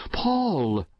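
high cry of alarm, falling away. A narrator's reading. Mother cries out on finding her son riding madly, alarmed cry. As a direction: call out the name in alarm. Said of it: "she cried".

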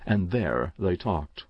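usual speaking voice

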